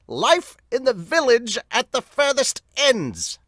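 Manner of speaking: high, slow, booming announcer; dramatic anime announcer voice; manga announcer voice. Acted. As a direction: announce the chapter title like an anime narrator. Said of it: "the announcer voice that I use for Fairy Tail".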